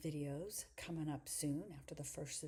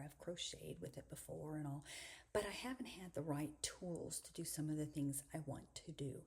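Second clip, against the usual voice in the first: normal talking voice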